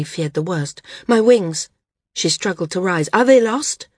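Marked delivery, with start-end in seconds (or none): 1.1-1.7 higher, louder cry of fear; sudden alarm. A narrator's reading. Maris suddenly fears the worst. 3.1-3.9 higher, louder panicked question; desperate to know; frightened cry. A narrator's reading. Maris struggles to rise, afraid.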